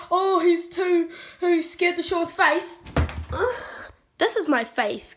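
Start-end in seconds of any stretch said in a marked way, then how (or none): 0.1-2.8 mock critic. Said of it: "before you say". 2.9-3.9 unvoiced sigh. Mock sigh before face reveal.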